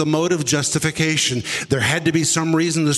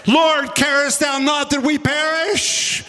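panicked scream; 10 semitones higher, slower than usual. Acted. Disciples waking Jesus in the storm, screaming in panic. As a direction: shout it in terror as the boat sinks. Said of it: "simultaneously screaming".

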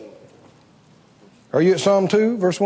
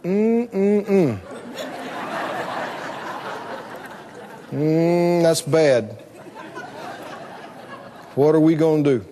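lightly voiced God in a low, troubled murmur; mock-worried God; 8 semitones lower, 6 dB quieter than usual. God reacting to the rebels, mock-worried and low. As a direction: hum and fret lightly as God, pitched low. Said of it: "he that sits in the heavens goes".

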